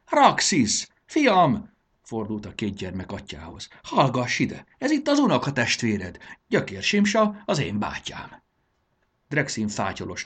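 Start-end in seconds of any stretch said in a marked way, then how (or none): 0.1-1.7 high, loud, joyful hail; delighted elder's shout; excited calling out. A narrator's reading. Delighted old man calls his son. 3.8-8.4 high, loud, eager announcement; elated old man presenting family; excited introduction. A narrator's reading. Old man excitedly introduces new-found relative.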